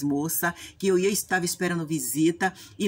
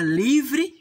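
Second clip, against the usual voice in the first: higher and louder on livre; joyful burst; 8 semitones higher, 6 dB louder than usual. Real, not acted. Relief after being forgiven.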